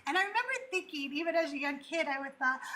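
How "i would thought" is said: usual speaking voice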